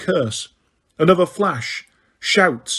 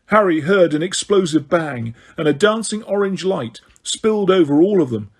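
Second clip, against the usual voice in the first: ordinary delivery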